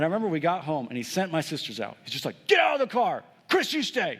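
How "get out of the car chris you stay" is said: sharp, much higher, louder furious command; angry dad's order. Retold or imitated. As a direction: bark it like a livid father. Furious dad ordering kids out.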